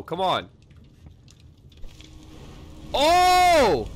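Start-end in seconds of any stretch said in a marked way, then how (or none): 2.9-3.9 sudden high, loud "oh"; startled by what just appeared; surprised yelp. Real, not acted. Sudden discovery in game.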